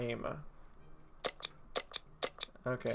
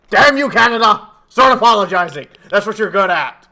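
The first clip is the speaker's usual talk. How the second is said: mock rage; 4 semitones higher, 9 dB louder than usual. Mock rant against Canada.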